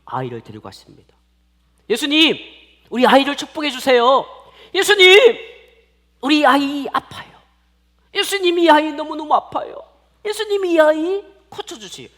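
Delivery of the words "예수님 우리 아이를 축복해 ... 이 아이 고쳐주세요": pleading parents. Acted. Parents beg Jesus to heal their child, loud pleading. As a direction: cry out desperately for the child's sake. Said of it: "그런 마음으로".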